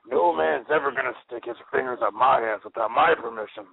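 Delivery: strained gravelly parody voice; mock Batman voice. Friend jokes why Batman talks like that, strained mock growl. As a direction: growl it like a hero in a tight suit.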